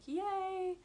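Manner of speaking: high, drawn-out yay at ordinary volume; mock little cheer. Vlogger recalling her day off to viewers, little cheer. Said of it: "which was like".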